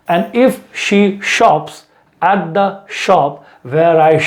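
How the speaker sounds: very slow, every sh drawn out; slow tongue twister. Shown as a demonstration. Teacher drills the sh and s tongue twister. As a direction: stretch each sh and s apart. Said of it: "Listen to it".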